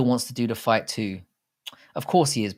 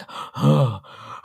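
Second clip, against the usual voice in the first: imitated gasping; 3 semitones higher than usual. Imitating Pavlovich gassed out after swinging wildly, mock gasping. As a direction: mimic a fighter blowing hard for breath. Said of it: "he was just like".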